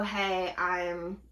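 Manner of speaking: normal talking voice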